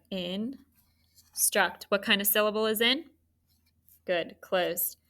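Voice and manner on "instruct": "instruct" slowly, a gap after "in"; split into syllables. Shown as a demonstration. Teacher showing how to scoop it, "instruct" split in two. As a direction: separate "in" from "struct" in two beats. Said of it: "How would we scoop this?".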